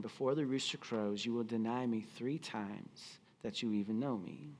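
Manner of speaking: low, soft echo of Jesus' warning; remembered words, hushed. A narrator's reading. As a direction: let it drift back quietly, like a memory. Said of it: "Jesus' words flashed through Peter's mind".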